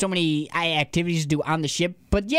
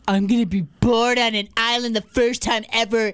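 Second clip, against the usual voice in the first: hammed-up first-world whine, pitched up; mocking complaint; 4 semitones higher, 4 dB louder than usual. Co-host mocks podcaster's first world problems, complaining. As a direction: mimic a spoiled complainer, higher than usual. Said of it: "your first world problems".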